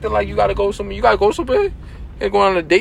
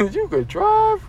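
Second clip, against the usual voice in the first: mocking; 13 semitones higher, much slower than usual. Teasing friend's parking.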